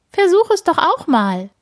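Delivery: high, sing-song call; trilling invitation. A narrator's reading. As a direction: lilt it high like a happy bird. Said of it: "trällert sie".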